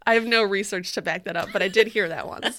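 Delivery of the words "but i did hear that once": usual speaking voice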